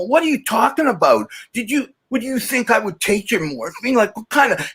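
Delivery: high-pitched, affronted retort from a retold argument; retold indignation. Addict retelling a reply to mom's morphine question, indignant. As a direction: reenact being offended at an accusation, pitched high. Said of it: "and I got really mad".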